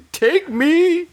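high, hammed-up wail; fake wailing. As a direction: cry out to be taken. Hammed-up mourner.

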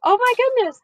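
high, loud, laughing surprise; surprised. Real, not acted. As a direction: gasp it out in disbelief, laughing. Learns her answer was wrong.

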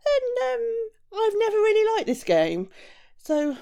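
high, wavering, nervous excuses; terrified giant. A narrator's reading. Giant backing out of the pole game, terrified. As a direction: back out timidly in a high voice. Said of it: "became so terrified when he heard".

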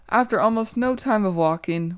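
plain reading voice

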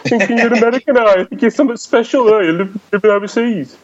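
high-pitched, hammed-up Brummie jeering; mocking Birmingham locals. Friends mocking returnee's American accent, jeering Birmingham accent. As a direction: needle him in an exaggerated Birmingham accent. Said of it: "And they're like".

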